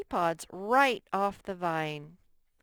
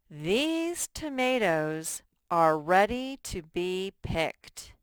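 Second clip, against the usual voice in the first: slow model reading; 5 dB louder, slower than usual. Shown as a demonstration. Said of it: "teacher reads model sentence".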